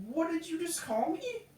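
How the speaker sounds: high-pitched incredulous question; retold disbelief. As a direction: act out affronted shock, pitch up high. Acts out reaction to being insulted.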